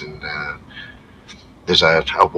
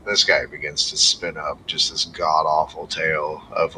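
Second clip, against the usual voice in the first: normal talking voice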